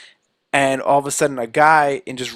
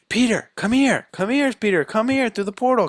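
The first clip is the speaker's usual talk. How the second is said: high-pitched imitation of calling someone over; urgent calling; 8 semitones higher than usual. Friends call Peter through the portal, imitated in a high calling voice.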